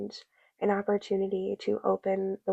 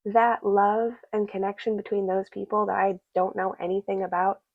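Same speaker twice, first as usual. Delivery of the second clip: ordinary delivery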